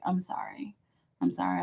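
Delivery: real sheepish whisper; whispering an apology to Carrie for the wrong name; whispered sorry. Real, not acted. Apologising after name mix-up.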